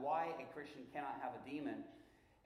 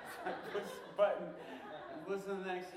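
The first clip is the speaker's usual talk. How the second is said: laughing; 4 semitones higher, 4 dB louder than usual. Real, not acted. Laughing while narrating.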